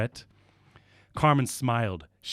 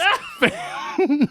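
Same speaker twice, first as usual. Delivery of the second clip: high shrieking laugh; 5 dB louder than usual. Real, not acted.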